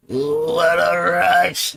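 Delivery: stretched very slow; drawn out. Shown as a demonstration. Slow drawn-out mock line to the viewers. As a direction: drag every sound out. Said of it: "No, there is no rush".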